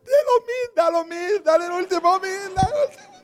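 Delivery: very high, loud blubbering plea; mock wailing. Wife begging husband to give the last thousand, wailing. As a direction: imitate hysterical sobbing through the words. Imitating weeping wife urging him.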